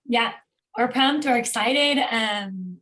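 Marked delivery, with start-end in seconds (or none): none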